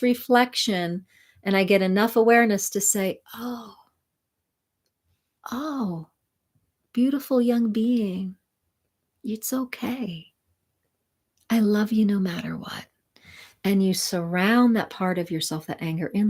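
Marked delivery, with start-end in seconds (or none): 3.3-12.9 tender whisper. Acted. Coach comforting her own angry inner self, tender whisper. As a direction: hush it like soothing a child.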